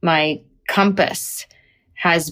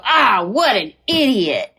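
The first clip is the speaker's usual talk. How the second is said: slow, swooping self-mockery; mock exasperation; 4 semitones higher, slower than usual. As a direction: groan it at your own dumb choice, playfully. Couple mocking her past decision.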